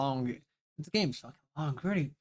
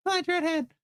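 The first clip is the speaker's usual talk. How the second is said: much higher teasing greeting; high mocking voice. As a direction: chirp it in a high voice.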